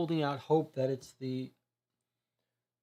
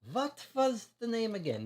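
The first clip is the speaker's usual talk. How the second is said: put-on higher, with a German-sounding accent; slipped into German accent; 8 semitones higher than usual. Consul asking the name, accidentally German-accented. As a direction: aim for French, land on German. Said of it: "That's a German accent".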